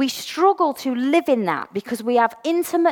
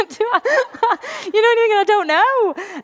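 giggly and high, laughing through the words; 10 semitones higher, 4 dB louder than usual. Real, not acted. Sharing a church story, laughing at her own confusion. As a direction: laugh at how lost you were.

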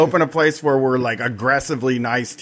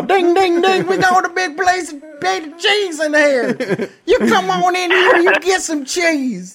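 much higher hammed-up country mama, laughing through it; Southern mama; 13 semitones higher than usual. Barefoot host calls folks in to eat, high laughing mock mama. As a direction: lay on the hillbilly mama voice, ringing the bell.

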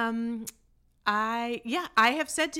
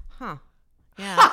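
impressed swoon; 10 dB louder than usual. Retold or imitated. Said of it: "I'm like".

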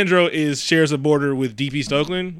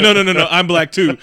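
laughing protest; 3 semitones higher, 6 dB louder than usual. Retold or imitated. Friend's reply, quoted laughing.

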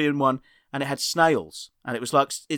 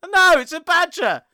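much higher, louder, unhinged squeaky comic voice; a very high, louder crazed clown act; frightening badger comic; 11 semitones higher, 4 dB louder than usual. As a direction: play a manic surreal comedian. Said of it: "It's frightening".

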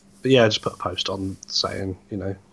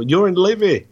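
put-on Scottish accent; 8 semitones higher, 5 dB louder than usual. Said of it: "That was Scottish".

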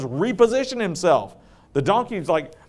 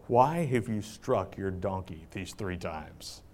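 much lower, softer, slow solemn angel; hushed, solemn angel rebuking Balaam; angel's voice; 11 semitones lower, 10 dB quieter, slower than usual. Acted. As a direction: speak as a grave heavenly messenger. The angel of the Lord speaks.

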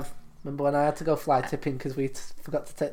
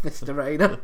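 normal talking voice

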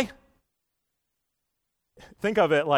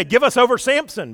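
mock demand; 10 semitones higher, 8 dB louder than usual. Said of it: "and they say".

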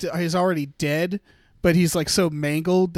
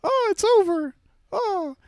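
high, tiny little voice; pixie; 14 semitones higher than usual. Retold or imitated. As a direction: squeak it like a small creature. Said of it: "a little voice outside that's like".

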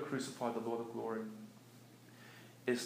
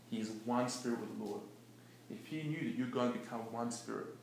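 usual speaking voice

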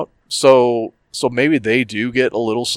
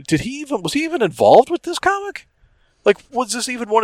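pitched way up in disbelief; incredulous; 11 semitones higher than usual. Retold or imitated. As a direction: squeak out the question in shock. Retold disbelief at the comic.

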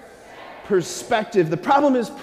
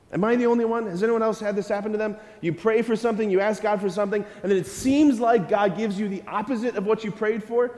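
usual speaking voice

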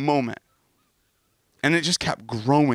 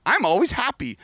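sing-song fake cheer, pitched up; mock chipper voice; 9 semitones higher than usual. Pastor mocking fake happiness in a bright chipper voice.

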